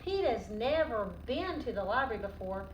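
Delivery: ordinary delivery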